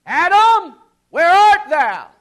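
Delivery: loud, high, slow cry of Adam's name; booming divine call. Pretend. God calling to hiding Adam, a booming cry. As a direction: call out across the garden.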